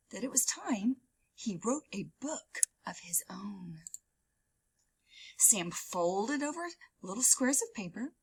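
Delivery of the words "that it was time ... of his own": hushed secret whisper; conspiratorial storytime whisper. A narrator's reading.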